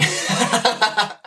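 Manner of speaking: high, laughing slacker whine; mock a friend who just wants to chill; lazy friend. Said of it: "That's what a lot of my friends would say".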